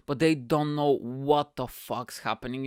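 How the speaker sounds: usual speaking voice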